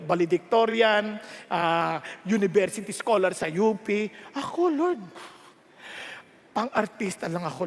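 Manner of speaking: ordinary talk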